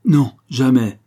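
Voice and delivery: forceful. A narrator's reading. Father contradicting his wife before his daughter, forceful. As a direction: refuse with full force. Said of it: "dit monsieur Flochard avec force".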